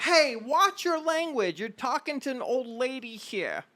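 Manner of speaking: high, slow scolding grandma; scolding old lady. Acted. Old woman scolding the caller's language, high and slow. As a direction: wag a finger at the caller.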